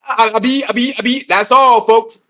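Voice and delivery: stuttering cartoon pig voice; Porky Pig stutter. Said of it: "Sound like Porky Pig".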